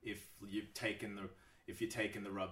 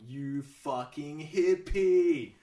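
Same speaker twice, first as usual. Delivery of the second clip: sneering jab, much higher and louder, very slow; mocking old self; 7 semitones higher, 15 dB louder, much slower than usual. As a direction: drag out the insult as the scornful old self. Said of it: "old [name] was kinda coming up on my left shoulder going".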